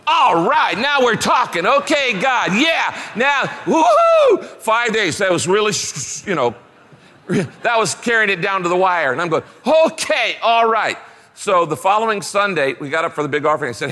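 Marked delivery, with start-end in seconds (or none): 0.1-4.4 high, loud retold cheer; a joyful woo-hoo; excited whoop. Preacher retelling his joyful reaction. 9.6-11.0 excited relief. Retold or imitated. Retold relief at the advance.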